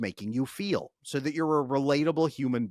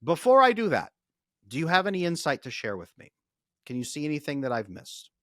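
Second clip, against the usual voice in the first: demo magic words; 3 semitones higher, 7 dB louder than usual. Shown as a demonstration. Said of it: "you say these magic words".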